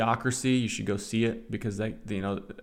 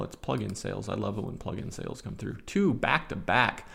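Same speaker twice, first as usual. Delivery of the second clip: normal talking voice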